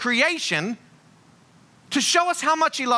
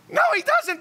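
"No, he doesn't" jumps much higher in mock protest; emphatic high. Real, not acted. Preacher insists to the church, squeaky high mock protest. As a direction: pitch it way up, half laughing. Correcting himself about the rapper.

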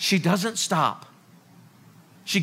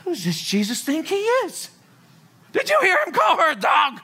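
mock outrage; 6 semitones higher than usual. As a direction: play the scandalised crowd.

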